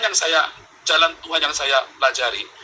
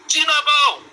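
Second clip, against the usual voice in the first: retold taunt; 11 semitones higher, slower than usual. Bullied by village kids, retold.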